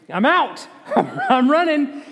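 high and slow, through laughter; giggling punchline; laughing. Real, not acted. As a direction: laugh as you say it. Joke about fleeing a talking donkey.

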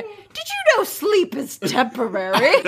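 high, naive princess. Retold or imitated. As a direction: chirp it brightly like a princess. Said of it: "She's like".

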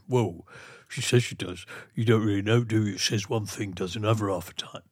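low Texan grumble; Texan accent. Acted. Texan complaining about her, low Texan accent. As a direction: pitch it down into a put-on Texan voice. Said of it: "a good one from a Texan".

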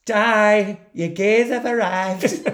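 high, loud camp shriek; camp quote. Retold or imitated. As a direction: shriek it theatrically. Quoting a film line, very high.

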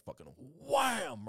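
high, slow, drawn-out wham; mock impact. Retelling the cane striking his numb leg, drawn-out wham. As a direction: land the word like the cane hitting.

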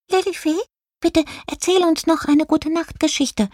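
very high, pleading mouse voice; begging mice. A narrator's reading. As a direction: beg for it in a tiny squeak. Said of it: "bettelten die Mäuse".

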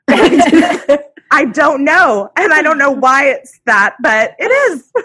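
words breaking into laughter; laughing. Real, not acted. Reader laughing at her own Scottish phrase, laughing. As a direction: laugh it out high and loud. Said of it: "laughing at her own phrase".

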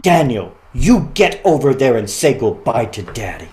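scolding big sister. Retold or imitated. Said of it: "I hear her scold her brother".

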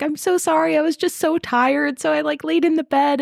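high, pleading mock-crying voice; tearful apology. Recapper voicing the crying kid who lost his shoe, tearful. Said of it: "he's, like, crying about it".

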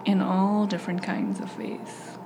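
hushed self-love meditation guide; guided meditation. Acted. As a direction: ease the listener into calm, breath by breath.

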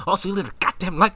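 high, fast mock-angry scold; imitating her snapping. Boyfriend imitates his girlfriend's scolding, fast mock anger. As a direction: rattle it off like an irritated girlfriend. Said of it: "she doesn't say it in that soft of a tone".

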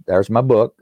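ordinary talk

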